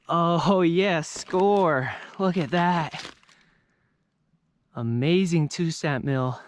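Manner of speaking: laughing with delight, high and loud; a big joyful find, burst out; excited. Real, not acted. Thrilled at finding the mill.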